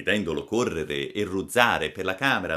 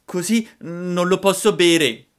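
high, loud whiny refusal; fussing puppet; 13 semitones higher, 13 dB louder than usual. A narrator's reading. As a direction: fuss like a spoilt little puppet. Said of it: "disse il burattino facendo mille smorfie". (burattino lamentoso e smorfioso)

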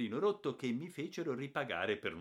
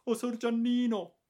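much higher, louder repeated cry; tearful maid; 10 dB louder than usual. A narrator's reading. Maid Caterina greeting the returned boy, louder tearful cry. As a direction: cry it out overcome with joy.

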